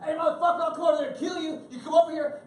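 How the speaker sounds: high, fast mock gangster yell; mock street tough. Tough guy yelling threats across the street, mock high yell.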